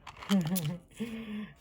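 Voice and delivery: real chuckle. Real, not acted. Teacher amused by her experiment, chuckling. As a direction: chuckle briefly to yourself. Laughs during the paper test.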